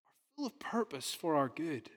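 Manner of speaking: whispered, almost secret. Acted. Preacher whispering comfort to church, unvoiced whisper. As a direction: lean in and breathe it.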